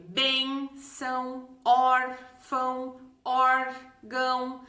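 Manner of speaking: "bênção, órfão, órgão" devagar, separando as duas sílabas; tônica fora do ÃO. Shown as a demonstration. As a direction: marque BÊN, ÓR, ÓR e pause antes do ÃO. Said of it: "receber o acento em outra sílaba". (palavras silabadas, bem pausadas)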